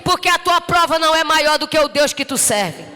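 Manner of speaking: loud, high, fervent preaching; rising revival sermon; preaching at full force. Acted. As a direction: preach it out to the whole church.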